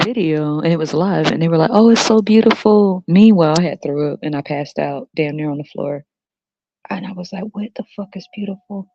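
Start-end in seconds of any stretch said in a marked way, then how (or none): 1.7-3.0 high, loud sugary awe; mock gushing. TV people cooing over a birth, mock gushing. 7.5-8.9 soft, higher-pitched bafflement; appalled disbelief. Retold or imitated. Kid answering TV gushing, hushed high disbelief.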